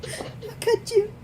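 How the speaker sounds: high, slow, laughing squeak; mock betrayal. Reader scolding the apologizing co-reader, mock betrayal. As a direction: draw out a squeaky, wounded reproach.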